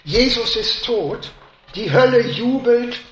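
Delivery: preacher building to a dramatic peak; full-force preaching. Acted. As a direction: thunder it from the pulpit.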